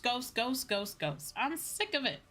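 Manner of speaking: ordinary delivery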